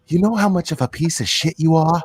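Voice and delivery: mock-serious scolding of internet trolls; imitating an upset rant. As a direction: act out a friend's earnest outrage. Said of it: "dead serious when he's that".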